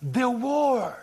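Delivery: high, loud call for attention, easing in the middle, then booming at the end; emphatic attention-grabbing shout; emphatic John. Acted. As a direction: call out urgently as John, punching the final words. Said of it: "As if John were saying here".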